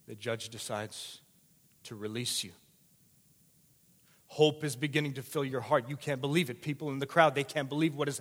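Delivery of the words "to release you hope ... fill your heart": ordinary talk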